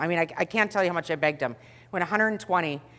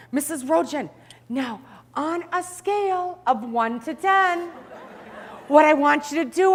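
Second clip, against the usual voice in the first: higher, slow, syrupy nurse-talk to a patient; sweet trainee; 8 semitones higher than usual. Retold or imitated. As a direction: talk down gently like to a child. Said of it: "he says".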